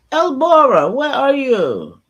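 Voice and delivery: calling out. Real, not acted. Calling out to absent listener.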